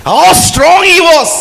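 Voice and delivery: drawn out slowly, loud and high with awe; awed exclamation. Real, not acted. Preacher marvelling to the congregation, loud and slow. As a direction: savour every word of wonder. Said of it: "preacher exclaims".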